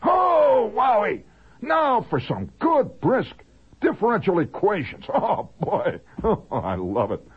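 high, loud chest-beating gusto; hammed-up morning zeal easing into a chuckle; mock-hearty enthusiasm. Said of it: "beats your chest and says".

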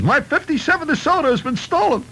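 high, whiny complaining voice; indignant car owner. Acted. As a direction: report the theft in a fuss. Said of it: "So he says".

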